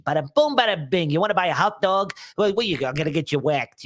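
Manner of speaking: wisecracking New York wiseguy accent; Joe Pesci. Shown as a demonstration. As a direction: rattle it off like a New York mobster. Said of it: "easiest New Yorker to impersonate is".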